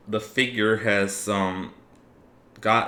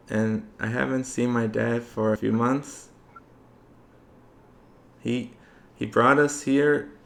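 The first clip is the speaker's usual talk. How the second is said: put-on little girl voice; 3 semitones higher than usual. Little girl answers party.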